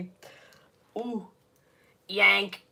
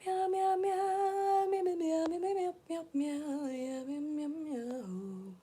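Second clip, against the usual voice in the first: cat meows; 8 semitones higher, 7 dB quieter, slower than usual. Pretend. Streamer meowing to chat.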